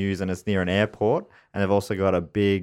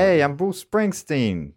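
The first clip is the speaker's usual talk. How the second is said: put-on Jersey accent; 6 semitones higher, slower than usual. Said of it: "what's a Jersey accent again".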